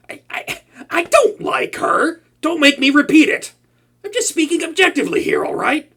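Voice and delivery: high, defensive insisting; flustered denial. Acted. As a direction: protest too much, rattled. Flustered denial about the hero.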